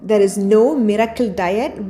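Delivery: normal talking voice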